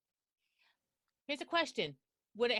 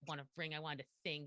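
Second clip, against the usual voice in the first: much lower, soft muttering; 10 semitones lower, 11 dB quieter than usual. Retold or imitated. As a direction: mutter it to yourself obsessively. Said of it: "if she's muttering".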